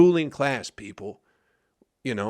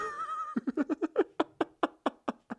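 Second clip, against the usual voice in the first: a high, breathy giggle at his own joke; 7 dB quieter than usual. Real, not acted. Host chuckling at his own joke, high giggle. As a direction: let a high laugh run out after the joke.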